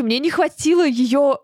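ordinary talk

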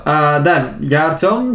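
ordinary talk, nothing marked

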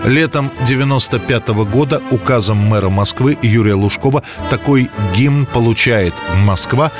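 ordinary talk

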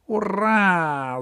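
high, gleeful cat cry; triumphant shout. Acted. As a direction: cheer it out like a winner. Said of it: "закричал кот".

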